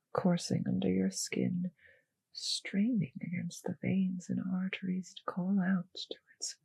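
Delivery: a savoring whisper that dies away; hungry whisper. Acted. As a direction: breathe it out, fading to nothing. Vampire savoring the pet's blood.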